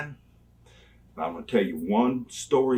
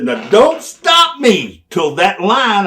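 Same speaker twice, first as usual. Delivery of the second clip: loud slow warning; 8 semitones higher, 10 dB louder, slower than usual. Retold or imitated. Instruction inside promo.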